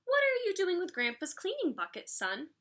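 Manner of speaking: lightly voiced mom questioning her son; a hint of a puzzled mother; mother asking. A narrator's reading. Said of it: "she asked".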